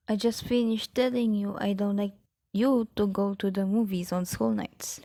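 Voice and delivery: normal talking voice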